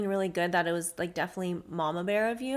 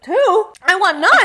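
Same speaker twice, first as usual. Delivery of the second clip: loud, high-pitched comic outburst, breaking into laughter; shocked disbelief; 11 semitones higher, 16 dB louder, slower than usual. Retold or imitated. Woman reliving her own answer about kids, shocked disbelief. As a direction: blurt it out aghast, very high and loud.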